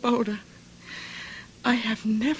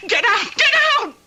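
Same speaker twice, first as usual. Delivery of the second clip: frantic shout; 9 semitones higher than usual. Acted. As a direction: drive him out screaming. Girl screams at the accuser.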